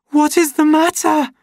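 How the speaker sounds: high, loud frightened cry; almost scream it at him; near scream. A narrator's reading. Lenina terrified of John, almost screaming. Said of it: "she almost screamed".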